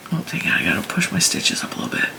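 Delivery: quick, whispered grumble to self; muttered aside. Real, not acted. Annoyed at her stitches, whispered quick mutter. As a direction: grumble it under your breath, quickly.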